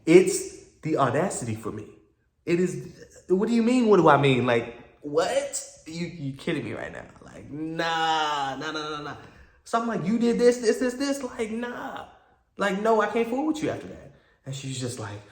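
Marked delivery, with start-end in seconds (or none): 0.0-9.2 high, loud disbelief; mock outraged retort to the ex; incredulous rant. Retold heated reply to ex. 10.1-14.1 much higher, incredulous; replaying the argument in outraged falsetto; disbelieving outburst. Retold heated reply to ex.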